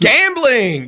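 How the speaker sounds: high, loud mock outburst; exclaimed. Friend reacting to Venom's gambling habit, loud exclamation. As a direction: burst out with theatrical alarm.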